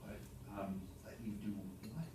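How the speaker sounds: hushed, barely voiced question; whispering. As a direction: lean in and ask it under your breath. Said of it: "whispering now".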